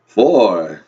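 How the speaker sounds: loud vampire count, falling low; big Transylvanian accent with a long fall. Acted. Count starting the count over, booming vampire accent. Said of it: "your vampire accent".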